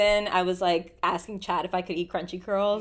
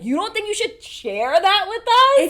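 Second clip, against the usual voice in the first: higher, louder, slow indignant ask; 12 semitones higher, 8 dB louder than usual. Real, not acted. Indignant complaint.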